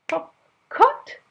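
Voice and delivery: loud, high barked command; sudden shouted Cut; sharp shout. Acted. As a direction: snap it out like a royal order. King's sharp command in story.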